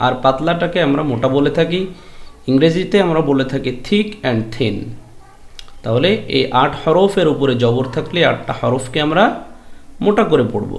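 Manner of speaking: ordinary talk, nothing marked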